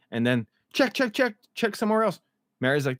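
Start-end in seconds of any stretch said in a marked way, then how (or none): 0.7-2.2 frantic. Retold or imitated. Fatima frantic at ultrasound.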